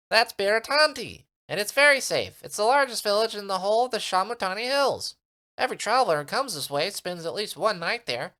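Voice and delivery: high, loud little creature voice; eager little creature asking for protection; tiny Minimite creature. Acted. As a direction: squeak it out like a tiny sprite.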